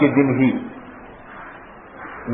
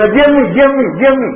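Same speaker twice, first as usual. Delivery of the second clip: higher, eager, sweet "jee ammi"; eager sweet reply; 7 semitones higher than usual. Pretend. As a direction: answer her at once, all sweetness. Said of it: "آپ نے خالی اس لہجے سے". (ساس کو فوراً میٹھے لہجے میں جواب)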